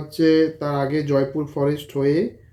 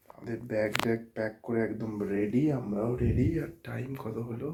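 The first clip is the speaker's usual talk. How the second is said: hushed voice; 4 semitones lower, 15 dB quieter than usual. Real, not acted. Friend filming at night before leaving, hushed so as not to wake family. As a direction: talk very softly so nobody wakes. Night departure, household asleep.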